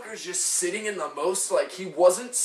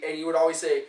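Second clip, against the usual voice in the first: usual speaking voice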